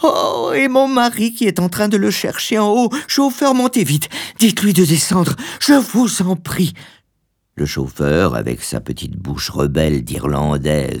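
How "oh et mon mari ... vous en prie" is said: very high, frantic wife's voice; high-pitched excited pleading; crying out excitedly. A narrator's reading. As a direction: call urgently to the chauffeur, pitched far up. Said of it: "s'écria-t-elle".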